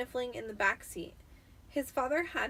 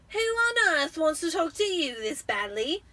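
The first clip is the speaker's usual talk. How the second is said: put-on higher, louder amazed boy; amazed Dudley; 7 semitones higher, 8 dB louder than usual. A narrator's reading. Dudley asking Harry in amazement, loud and high. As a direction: gape at it in wonder. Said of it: "Dudley asked Harry in amazement".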